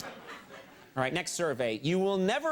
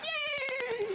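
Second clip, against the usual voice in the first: squealed high, mock-excited yay; a hammed-up high squeal of joy; mock cheer. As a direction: squeal it like a giddy fan. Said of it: "Now they're like".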